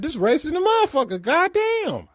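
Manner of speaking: hammed-up shock at the jar; mock outrage. Telling friends about the Nutella jar, mock outraged squeal. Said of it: "I said".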